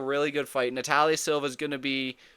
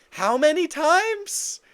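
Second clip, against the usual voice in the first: slower, higher and louder incredulous repeat; 13 semitones higher, 6 dB louder, slower than usual. Real, not acted. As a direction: lean into the disbelief. Heated rant about knockdowns.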